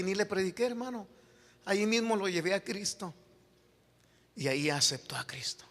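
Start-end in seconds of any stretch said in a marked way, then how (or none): none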